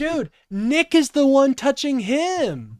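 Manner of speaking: loud, slow, indignant yell at the screen; outraged shout. Real, not acted. Streamer yelling at the clip in outrage. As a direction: bellow it in disbelief, dragging it out.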